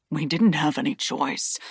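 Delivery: a hint of pleading. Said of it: "a hint of pleading in his voice".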